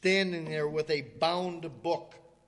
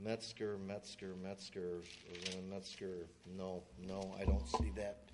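low, soft searching mumble; a maitre d' murmuring as he scans the book; muttering to himself; 9 semitones lower, 11 dB quieter than usual. Retold or imitated. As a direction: read names under the breath while hunting the list.

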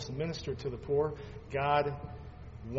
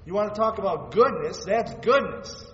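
louder and higher, hitting the climax; building preacher's cry; 6 semitones higher, 9 dB louder than usual. Acted. Pastor to congregation on the cross, preaching at full force. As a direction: preach it out, voice rising high.

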